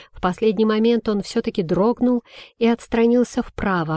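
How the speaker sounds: ordinary delivery, nothing marked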